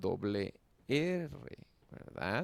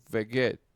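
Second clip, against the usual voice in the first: "Veget" said with a firm, louder accent; accent "Veget" without doubling the consonant; stressed consonant; 8 dB louder than usual. Shown as a demonstration. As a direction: punch "Veget" as one unbroken word. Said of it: "simplemente lo acentúa".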